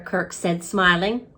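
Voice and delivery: usual speaking voice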